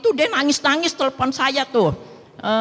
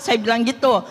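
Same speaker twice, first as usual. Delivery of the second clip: ordinary talk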